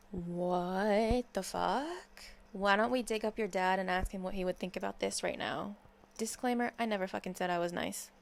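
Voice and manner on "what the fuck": very slow, drawn-out disbelief; stunned disbelief. Real, not acted. Reacting to [name]'s quote, slow stunned disbelief. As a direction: stretch it out, stunned.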